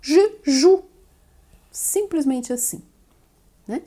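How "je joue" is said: joue with a pure Portuguese-like u; joue, clean ou; French je joue. Shown as a demonstration. Teacher links joue to the u in uva. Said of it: "Esse U".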